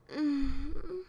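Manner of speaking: pleased hum; contented hum. A narrator's reading. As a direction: hum over the muffin. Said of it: "she hummed".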